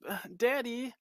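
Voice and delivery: high, pleading little "Daddy"; whining daughter. Acted. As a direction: whine for daddy's help. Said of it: "she says".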